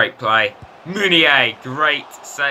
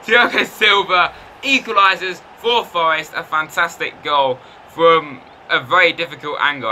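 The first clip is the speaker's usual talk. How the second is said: excited match call, higher; goal commentary; 6 semitones higher, 5 dB louder than usual. Real, not acted. After the goal, excited commentator call. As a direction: announce the equaliser like a commentator.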